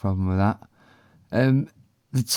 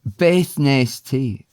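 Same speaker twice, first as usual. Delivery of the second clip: slow Welsh model; 6 semitones higher, 4 dB louder, much slower than usual. Shown as a demonstration. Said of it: "What did you?".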